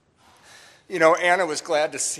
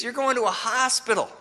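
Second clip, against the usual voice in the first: laughing mockery; 10 semitones higher than usual. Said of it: "and he laughed".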